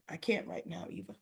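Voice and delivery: voiceless whisper, trailing off; whispered refusal. Retold or imitated. Ted turning away from Eva: weary whisper. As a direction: breathe it out as a whisper, worn out.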